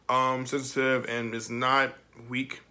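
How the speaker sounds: ordinary talk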